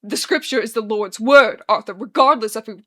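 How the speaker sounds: loud, angry insistence; snarl. A narrator's reading. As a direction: snarl it as absolute law. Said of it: "Daniel gnarls".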